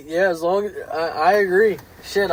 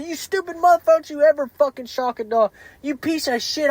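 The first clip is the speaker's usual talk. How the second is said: imitating a screaming sister-in-law, pitched up; mock furious yell, higher; retold screaming; 8 semitones higher than usual. Sister-in-law screaming at him for shocking a dog, mock furious. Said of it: "screaming and fucking freaking out on me".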